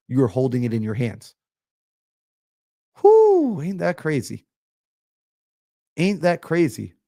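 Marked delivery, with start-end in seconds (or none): 3.0-3.6 high, loud "whoo"; excited whoop. Real, not acted. Preacher amazed by his own point about faith, excited whoop. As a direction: let out a big high whoop.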